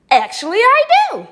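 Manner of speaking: high, loud exclamation; hammed-up enthusiastic yes; emphatic answer. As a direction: belt it out eagerly. Emphatic answer about spirits.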